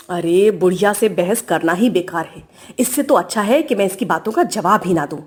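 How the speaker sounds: low, fed-up grumble to herself; resigned aside. Acted. Daughter-in-law decides not to answer the old woman, resigned. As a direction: drop the pitch and give up arguing.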